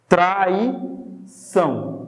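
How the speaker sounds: "Traição" very slow, pause before the final syllable; demonstrating S sound. Shown as a demonstration. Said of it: "Não é o mesmo som de S?".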